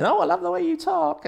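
very high, loud put-on female voice; squeaky mock swooning in falsetto; admiring women. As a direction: imitate gushing women fans. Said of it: "all the women are saying".

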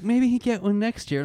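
high, slow mock parent. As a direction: play the placating parent. Parents' reply, put-on voice.

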